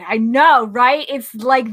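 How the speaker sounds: ordinary talk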